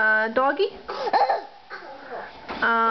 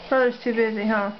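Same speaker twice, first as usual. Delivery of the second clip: ordinary delivery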